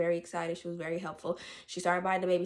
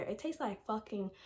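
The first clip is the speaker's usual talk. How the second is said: ordinary talk, nothing marked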